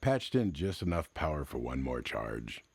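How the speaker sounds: usual speaking voice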